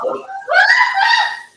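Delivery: a high, loud laughing shriek; real shrieking laugh; loud burst of laughter. Real, not acted. Paint all over her hands.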